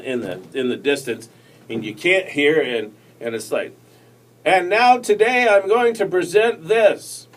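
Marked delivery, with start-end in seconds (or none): 4.4-7.3 distant, hollow, higher and slower; far off from the mic. Shown as a demonstration. Showing viewers how speaking far from the mic sounds, distant. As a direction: sound like you're across the room from the mic. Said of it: "you're way off in the distance".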